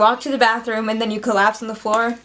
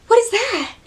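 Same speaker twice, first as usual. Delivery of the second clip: other person; 9 semitones higher than usual. Acted. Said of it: "I'm the other person".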